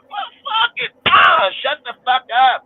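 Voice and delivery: annoyed yelling, very high; exasperated shout. Real, not acted. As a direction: blow up at them. Annoyed at people's stupid talk.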